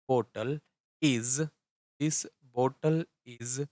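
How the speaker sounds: word by word, with long pauses; halting write-along pace; slow dictation. Real, not acted. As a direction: dictate slowly while writing, repeating each piece. Dictating sentence while writing it.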